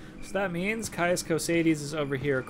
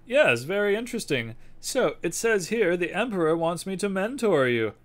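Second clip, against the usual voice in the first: put-on louder, raised old spy boss; spymaster voice; 6 semitones higher, 7 dB louder than usual. Spymaster asking the courier for the package, gruff. Reads NPC dialogue in character.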